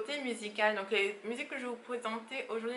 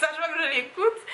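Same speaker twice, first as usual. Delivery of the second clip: higher, louder, quick laughing words; 7 semitones higher, 7 dB louder, faster than usual. Real, not acted. Confessing the song is super sexy, laughing. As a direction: giggle through it, faster and pitched up.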